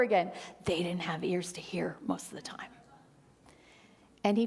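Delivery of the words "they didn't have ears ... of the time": soft, breathy confiding whisper; whispered aside. Real, not acted. Preacher confiding to the congregation, in a whisper. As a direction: drop to a secret whisper.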